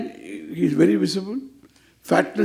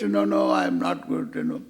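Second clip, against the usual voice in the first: gloomy complaint; 13 semitones lower, 5 dB quieter than usual. Retold or imitated. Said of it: "You should not say that".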